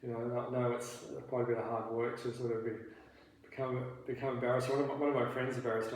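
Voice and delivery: ordinary talk, nothing marked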